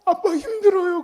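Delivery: put-on high, tearful child's whine, half laughing; a son's mock whimper; imitating a crying child. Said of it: "막 울어". (우는 아이 흉내, 높은 목소리)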